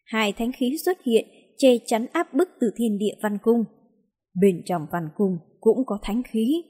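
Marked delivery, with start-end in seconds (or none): none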